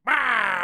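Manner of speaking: sudden loud, high mock scream; jump-scare yell, hammed up; imitated goblin jump-scare scream. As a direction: burst out with a monster's 'Ah!'. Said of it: "where he's just like".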